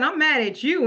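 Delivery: imitating an upset child, pitched high; mad little boy. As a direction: voice a cross kid's high-pitched complaint. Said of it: "he was mad".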